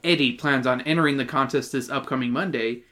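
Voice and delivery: ordinary delivery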